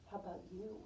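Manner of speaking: quick soft question after a gasp; hushed gasp. Pretend. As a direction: lean in with a gasp, softly.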